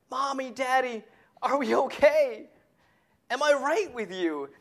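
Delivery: put-on child pleading. Child voice imagined.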